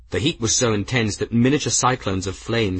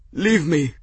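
higher, strained gasp; gasped plea; 7 semitones higher than usual. A narrator's reading. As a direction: gasp it out as an injured monk. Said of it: "the monk gasped".

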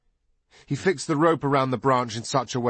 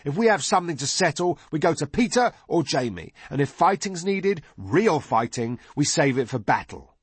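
put-on high, insistent boy's voice; indignant boy; 7 semitones higher than usual. A narrator's reading. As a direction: lay down the rules as an outraged boy. Narrator voices Fogg laying down rules.

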